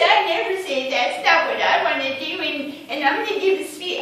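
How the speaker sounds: high, loud mimicking whine; exaggerated complainer voice; mocking whiny imitation. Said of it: "I never talk like that".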